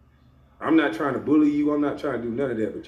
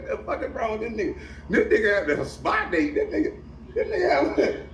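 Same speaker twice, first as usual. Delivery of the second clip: squeaky mock outrage, much higher; 9 semitones higher than usual. Mocking the podcaster's hair in a high, laughing gossip voice. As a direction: lampoon the guys gossiping about his hair. Mocking how others see him.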